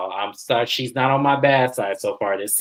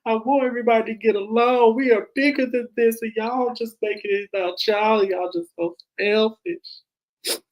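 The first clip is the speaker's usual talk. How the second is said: mock crying; 10 semitones higher than usual. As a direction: imitate a tearful complaint, stretching the words. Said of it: "Letitia is crying. She goes".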